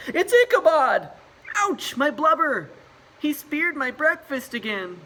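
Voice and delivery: very high, loud squealing panic; nervous whales. Acted. Other whales panic when Ichabod bumps them, very high and loud. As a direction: yelp as the tusk hits.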